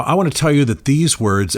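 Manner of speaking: ordinary talk, nothing marked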